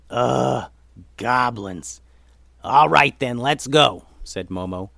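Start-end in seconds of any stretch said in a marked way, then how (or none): 0.1-2.0 drawn-out exasperated groan; fed-up groan, then resigned; grudging disgust. Acted. Momo grudgingly gives in to goblins. 2.6-4.0 loud, decisive order; rally the group to move; brisk leader's call, pushed loud; commanding. Acted. Momo gives the order to move.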